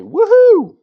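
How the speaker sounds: high, loud joyful whoop. Acted. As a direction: whoop it out like a hotshot pilot. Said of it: "He whoops loudly".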